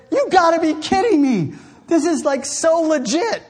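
high, loud disbelieving cry; incredulous outburst. Retold or imitated. Preacher retelling his own shocked reaction, high and loud. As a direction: throw up your hands in disbelief. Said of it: "I said".

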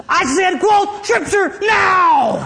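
high, loud, slow shout of every word; shouted demand. Retold or imitated. Wife in labor grabbing his collar, shouting the demand. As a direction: yell it at him, dragging each word out. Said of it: "she just grabbed me by my collar".

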